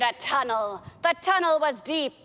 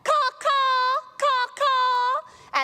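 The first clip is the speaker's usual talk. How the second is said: parrot caws; 12 semitones higher, much slower than usual. Acted. Pirate caws like a parrot to call for help. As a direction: caw like a bird calling for help. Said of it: "I'll call for help".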